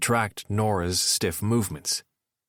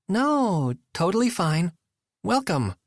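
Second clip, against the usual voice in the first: tight, higher forced brightness; forced cheer; 10 semitones higher than usual. A narrator's reading. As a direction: say it fine through a tight jaw. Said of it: "jaw tight, as she replied".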